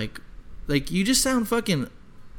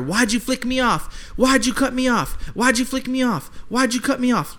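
higher, pressing question over and over; repeated demand; 6 semitones higher than usual. Retold or imitated. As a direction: hammer the question at her. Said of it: "He's just telling her".

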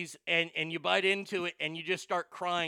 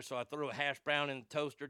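usual speaking voice

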